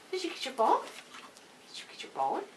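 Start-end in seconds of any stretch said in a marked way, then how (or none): none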